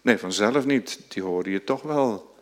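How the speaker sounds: usual speaking voice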